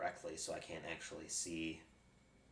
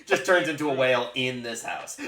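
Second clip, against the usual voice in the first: blurted through loud laughter; can barely get it out laughing; 10 semitones higher, 20 dB louder than usual. Real, not acted. Table cracks up at whale joke.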